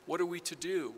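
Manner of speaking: ordinary delivery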